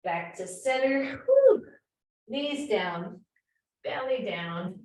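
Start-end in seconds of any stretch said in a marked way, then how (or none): 1.2-1.7 relieved whew. Real, not acted. Exhale after hard plank.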